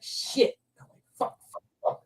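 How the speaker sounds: breathless whispered cursing under his breath; hushed panic, barely voiced; whispered swearing. Whispering curses at the charging dog.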